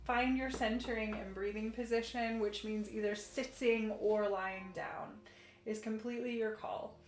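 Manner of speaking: soft, soothing class guidance; calm yoga instruction. Acted. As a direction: guide gently into stretches. Leads a gentle yoga session.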